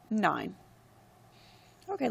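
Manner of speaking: ordinary talk, nothing marked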